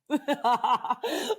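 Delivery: bright high laugh; a real burst of laughter; amused laugh at the story. Real, not acted.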